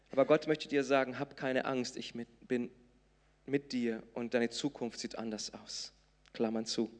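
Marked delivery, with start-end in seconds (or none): none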